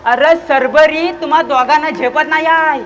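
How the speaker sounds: ordinary delivery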